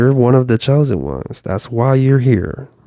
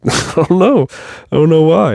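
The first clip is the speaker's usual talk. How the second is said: higher and laughing as he says he doesn't know; laughing through the quote; 8 semitones higher than usual. Retold or imitated. Vlogger retelling Jim Rohn's puzzled quote, laughing high. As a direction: laugh out the bafflement, pitched up. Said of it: "he's like".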